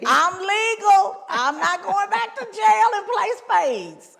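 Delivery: high and loud proud insistence; emphatic declaration. Real, not acted. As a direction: proclaim it proudly.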